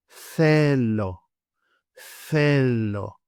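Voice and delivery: "θέλω" slowly, with a long soft th. Shown as a demonstration. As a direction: draw out "θέλω" as thhhe-lo. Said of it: "starting with the soft T H sound".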